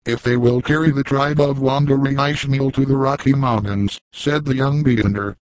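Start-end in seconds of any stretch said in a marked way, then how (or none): none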